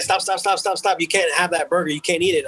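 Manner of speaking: high, urgent mock nurse pleading stop; frantic nurse. Nurse rushes in stopping him.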